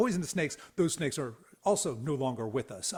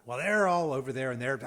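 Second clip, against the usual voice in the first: ordinary delivery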